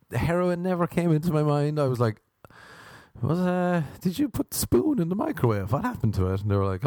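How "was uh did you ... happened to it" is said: high-pitched, hesitant naive question; mock-naive, lifted voice; innocent puzzlement. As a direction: play clueless and genuinely puzzled. Naive question to drug-using housemates.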